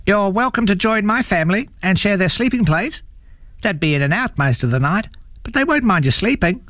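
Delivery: high, chatty possum voice; friendly possum. A narrator's reading. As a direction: make a cheerful offer in a high voice. Possum kindly invites troll home.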